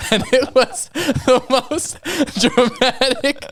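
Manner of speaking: high, slow, breaking up with laughter; helpless giggly retelling; laughing. Real, not acted. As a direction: struggle to get it out through laughter. Cracking up while retelling the scream.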